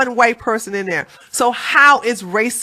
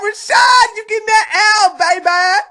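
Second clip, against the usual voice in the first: taunting; 11 semitones higher, 4 dB louder than usual. Real, not acted. Host taunts guest.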